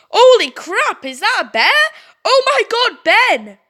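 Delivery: shocked exclamation. Acted. Tom sees the bear.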